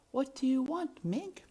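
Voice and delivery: very high princess voice; gentle princess. A narrator's reading. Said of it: "the princess said softly".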